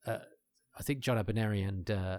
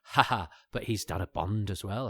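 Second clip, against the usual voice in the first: ordinary talk, nothing marked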